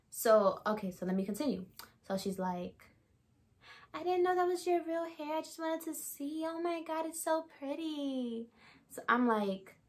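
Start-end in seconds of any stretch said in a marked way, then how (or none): 3.9-8.5 high, soft, gushing mimicry; put-on sweet voice, higher and softer; imitating Emily. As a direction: mimic a coworker cooing in a high, small voice. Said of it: "this is how she talks".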